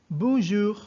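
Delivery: "bonjour" pronounced in French, model for the class. Shown as a demonstration. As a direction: say "bonjour" the French way, nasal bon, throat R. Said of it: "we pronounce it as".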